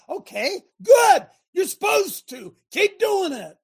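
high, loud cheer; coach praise. Acted. Coach cheering on hard workers, high and loud. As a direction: fire them up.

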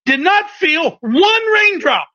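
high, slow, shouted emphasis; preaching shout. Real, not acted. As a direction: hammer it home to the congregation.

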